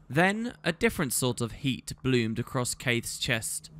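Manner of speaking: plain reading voice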